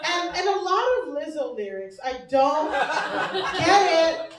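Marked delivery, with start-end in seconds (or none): none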